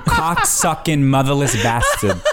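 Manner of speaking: a light Brooklyn mom cursing out a driver; lightly voiced Brooklyn road rage, R's dropped; mother's Brooklyn accent. Imitating his mother yelling at traffic, mild Brooklyn accent. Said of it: "a perfect impression of my mother".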